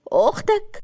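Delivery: high, startled question; puzzled surprise. A narrator's reading. Tahani questions Asem about his sister, surprised. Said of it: "بصت له باستغراب وقالت".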